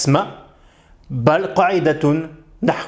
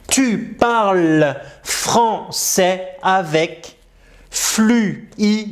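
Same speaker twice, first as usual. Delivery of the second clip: slow, careful model reading; much slower than usual. Shown as a demonstration. Teacher rereads the sentence slowly for the learner. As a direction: read it slowly and clearly.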